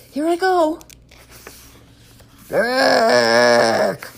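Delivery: high, loud trumpet blast; elephant noise. Acted. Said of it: "I'm trying to speak elephant".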